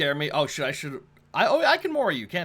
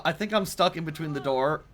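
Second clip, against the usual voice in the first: ordinary talk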